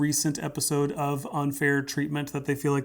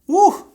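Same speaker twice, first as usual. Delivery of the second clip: excited woo; 14 dB louder than usual. Real, not acted. Pastor exclaims over quote.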